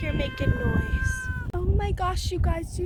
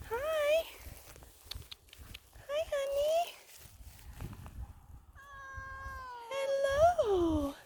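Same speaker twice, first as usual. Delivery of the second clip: cooing to a kitten; 7 semitones higher than usual. Real, not acted. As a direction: gently coax a frightened cat out, voice raised high. Soothing the meowing cat.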